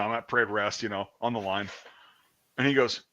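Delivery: ordinary talk, nothing marked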